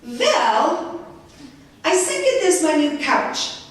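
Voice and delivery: put-on high, lightly accented reply; Hana's Czech accent. Hana answers the reporter on network TV in a high accented voice. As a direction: answer in a high, foreign lilt. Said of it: "Hana Mandlikova says to me".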